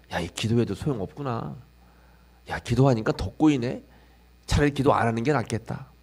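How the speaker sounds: 작게 중얼거리는 체념; 힘 빠진 목소리로 포기하듯; 체념한 혼잣말. Acted. 시험에 든 신자의 혼잣말. (시험에 든 신자가 작게 체념하는 혼잣말)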